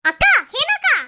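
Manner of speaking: loud, high call after someone. Acted. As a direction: call her back urgently. Calling after departing senior. (பின்னாலிருந்து சத்தமாக அழைத்தல்)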